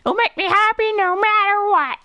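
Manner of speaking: very high cartoon character voice; goofy, high impression reading; Carl Weezer impression. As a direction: put on a silly, squeaky high voice. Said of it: "Carl Weezer, twenty twenty-five".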